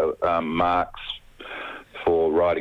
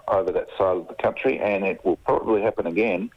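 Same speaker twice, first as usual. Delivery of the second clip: phone held away. Real, not acted. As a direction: voice distant from the phone. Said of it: "can I get you to talk into the phone a little bit better?".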